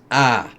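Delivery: short loud battle cry. Acted. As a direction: shout as you swing the axe. Angel strikes.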